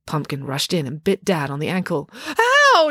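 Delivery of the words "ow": much higher, louder ow of sudden pain; pained yell. A narrator's reading. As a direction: yelp as the dog bites. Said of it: "yelled Dad".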